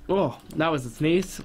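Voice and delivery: ordinary delivery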